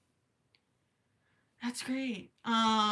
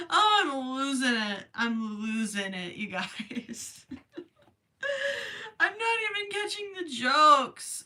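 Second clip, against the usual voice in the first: laughing; 5 semitones higher, 3 dB louder, slower than usual. Real, not acted. Laughing fit after joke.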